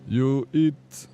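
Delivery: put-on menacing Russian accent on a harmless question; a hammed-up Russian accent that sounds like it wants to kill you; mock Russian accent. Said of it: "it's like they wanna kill you".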